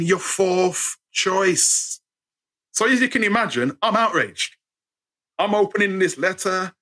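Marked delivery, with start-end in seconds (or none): 0.0-2.0 very slow, far voice, dwelling on you're; slow pointed quote. Retold or imitated. Quoting the employer's letter, drawn out and pointed. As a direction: let the insult hang, slowly.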